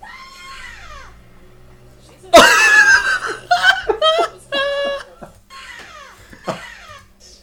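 shrieking burst of laughter; loud laugh. Real, not acted. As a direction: howl with laughter. Watching hammer thrower scream.

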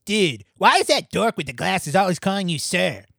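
a hint of a griping cartoon boy; lightly put-on voice for a kid's question; lightly voiced cartoon kid. Cartman asking Peppermint Patty about her nickname, lightly voiced kid.

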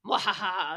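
play-acted "mwahaha" of triumph; mock evil cackle. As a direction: cackle like a pretend schemer. Mocks herself as a scheming villain.

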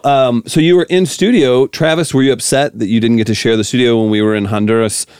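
ordinary delivery

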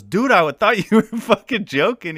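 very high, loud protest; mock shock. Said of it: "like, shocked".

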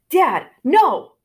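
loud, high cry of alarm; shout. A narrator's reading. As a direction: shout to stop your dad. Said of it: "she shouted".

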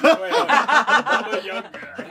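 laughing try at a Russian woman's accent, very slow; giggling Russian grandma attempt. Shown as a demonstration. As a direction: drag out Baba Yaga in a failed Russian accent. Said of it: "I can't do a girl Russian voice".

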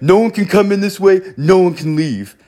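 high, loud bossy voice; imitating power-tripping guard. Mocking the guard refusing to let them leave, high and bossy. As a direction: bark the rule like a petty guard. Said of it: "power trip mode".

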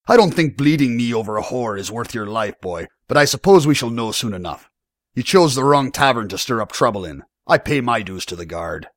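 threatening barman, loud at first then easing; defiant tavern owner. Acted. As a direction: warn the young upstart off.

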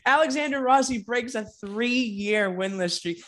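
slow, laughing through it. Real, not acted. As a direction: chuckle the words out. Laughing at the news.

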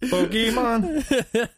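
laughing mock trailer voice; mock announcer, laughing. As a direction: stretch Pokemon out like a cheesy ad, cracking up. Mocking Pokemon announcer.

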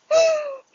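sudden shrill burst of laughter; high squeaky laugh. Real, not acted. Laughing about the friend zone.

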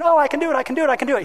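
put-on high, thrilled kid; child's voice. Child on the bike thrilled to ride alone, high voice. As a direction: delight of a kid riding alone. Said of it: "They're saying".

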